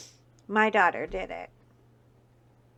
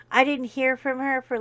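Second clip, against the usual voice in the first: normal talking voice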